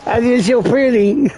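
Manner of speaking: high, far-off preening voice; vain mirror voice. Retold or imitated. Smurf-eater admiring himself in the mirror, high and vain. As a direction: admire yourself, high and silly. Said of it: "you go straight to the mirror".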